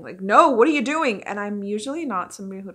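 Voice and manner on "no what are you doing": louder, higher exclamation at the TV; stressed outburst. Retold or imitated. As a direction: blurt it out, stressed and loud. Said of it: "when I get excited like this or stressed out".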